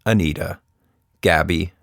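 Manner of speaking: usual speaking voice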